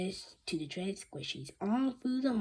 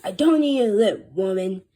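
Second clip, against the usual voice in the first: loud angry growl; 15 dB louder than usual. Acted. Principal scolding the secretary, loud growl. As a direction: growl it at her. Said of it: "Mister Crow growled".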